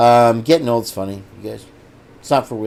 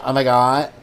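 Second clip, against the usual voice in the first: usual speaking voice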